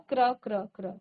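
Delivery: क्र with half क and full र, repeated; drilled kra. Shown as a demonstration. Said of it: "तो क आधा आया और र आपका पूरा आया".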